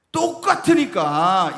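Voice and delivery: 똑같으니까 slow and loud, every syllable weighted; emphatic. Acted. Preacher stressing 똑같으니까 to congregation, slow and loud. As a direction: drag out 똑같으니까 with force.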